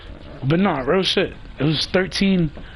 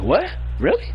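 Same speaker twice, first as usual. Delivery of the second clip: mock surprise; 4 semitones higher than usual. Quoting confused leader.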